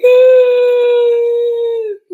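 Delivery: long, high, loud wail; hammed-up sobbing cry held on one note; mock crying wail. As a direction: wail it out like exaggerated bawling. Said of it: "crying all the time and be like".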